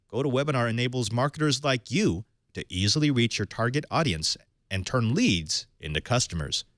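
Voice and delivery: ordinary delivery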